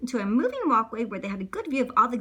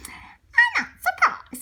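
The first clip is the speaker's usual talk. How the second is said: Paddington bear voice; 8 dB louder, much slower than usual. Acted. Paddington's reply voiced in a story.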